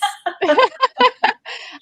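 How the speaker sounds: a high, loud laugh; high burst of laughter. Real, not acted. Host laughs at remark.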